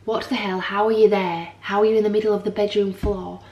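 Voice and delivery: panicked outburst. Retold or imitated. Panicked at baby on floor.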